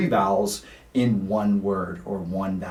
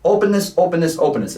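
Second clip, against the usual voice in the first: "openness" called out higher and louder, repeated; emphatic openness; 6 semitones higher, 4 dB louder, faster than usual. Shown as a demonstration. Coach stressing the central theme to singers, emphatic and raised. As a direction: hammer the word "openness" home. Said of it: "that's openness".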